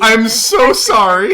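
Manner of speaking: loud, high, stretched-out sorry; loud drawn-out apology. Real, not acted. Game master apologising to the players, loud and drawn out. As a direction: belt out a slow, high apology. Exaggerated apology over the table chatter.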